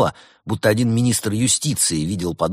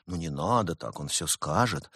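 soft good cop; 3 semitones lower, 8 dB quieter than usual. A narrator's reading.

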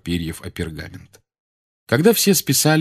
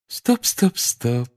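high, sweet but firm stop; sugary Umbridge voice; 4 semitones higher, much slower than usual. A narrator's reading. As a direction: halt them with sticky sweetness. Umbridge interrupts.

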